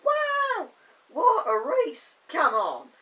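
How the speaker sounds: loud, high, hammed-up race cheer; excited cheering. Reacting to Thomas and James racing, loud mock excitement. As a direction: cheer the racers on at full voice.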